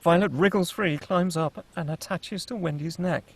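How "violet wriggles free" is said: ordinary talk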